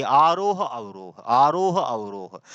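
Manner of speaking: slow आरोह swelling upward, अवरोह sliding down; singsong up on आरोह, down on अवरोह; rise and fall. Shown as a demonstration. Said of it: "शब्दों के उच्चारण में आने वाले आरोह अवरोह".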